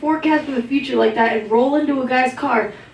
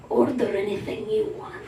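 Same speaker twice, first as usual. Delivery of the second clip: low, soft, mock-seductive offer; hint of a smooth talker leaning in; lightly voiced smooth date; 4 semitones lower, 9 dB quieter than usual. Comic imitating her date at dinner, softly low and smooth. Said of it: "He looks me in the eye and he goes".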